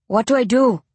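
unsure newcomer asking for help, a touch nervous; new hunter nervous, asking what to do. Acted. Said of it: "He sounds nervous".

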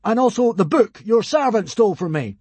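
high, loud godly demand; booming, commanding proclamation; crying out. A narrator's reading. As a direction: demand it grandly as a god. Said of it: "cried Hiccup".

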